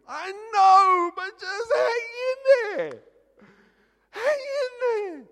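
very high, loud mock wail; desperate pleading. Pastor to bored Bible reader, desperate high pleading. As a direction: squeal it out, begging hard.

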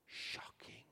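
a dramatic whisper. Real, not acted. Preacher hushing to stress the shock, whispered. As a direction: drop to a hush on shocking.

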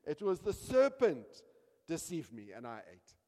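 hammed-up protest, high and loud, then dropping back; defensive blame-shifting. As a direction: pass the blame, loud then falling away. Acts Eve shifting blame onto serpent.

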